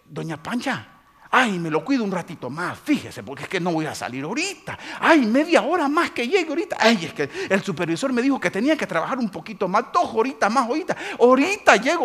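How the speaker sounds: high, loud, wheedling worker making excuses; pleading worker. Retold or imitated. Worker on the phone to doña Pancha, pleading.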